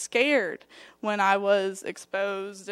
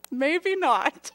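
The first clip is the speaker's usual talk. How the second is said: high-pitched sheepish dodge; mock-innocent, raised evasive voice; coy backtracking; 6 semitones higher, 3 dB louder than usual. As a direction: take it back coyly, up high. Caught out by boss, backtracking.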